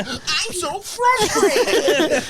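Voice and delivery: high, very slow strangled whine; honky frustration noise. Shown as a demonstration. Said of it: "I can only say it in this sound".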